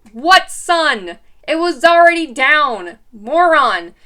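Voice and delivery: incredulous, scornful put-down; exasperated retort. Retold or imitated. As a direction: snap back at him, fed up. Mocking boyfriend's excuse, exasperated.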